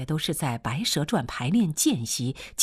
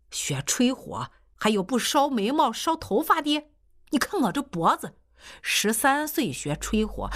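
put-on a higher, exasperated old actor brushing off the joke; old teacher retorting; 8 semitones higher than usual. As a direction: set the record straight, half amused. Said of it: "苟老师就说了". (苟老师反驳老汉，略带不耐烦)

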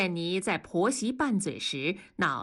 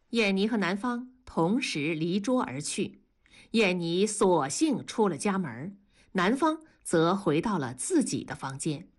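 plain reading voice

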